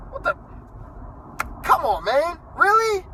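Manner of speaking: very high, incredulous Really; exasperated disbelief. Real, not acted. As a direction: rise right up in disbelief. Disbelief at accusation.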